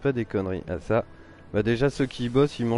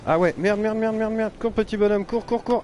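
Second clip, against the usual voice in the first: high panicked cursing, urging on; frantic "run, run" as danger closes in; being chased; 9 semitones higher than usual. Real, not acted. Character chased in game.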